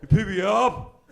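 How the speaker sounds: put-on slow, loud slurred teen voice; a lightly voiced slack-jawed teenage son; mumbling teenager. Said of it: "I can't understand him".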